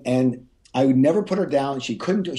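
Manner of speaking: ordinary delivery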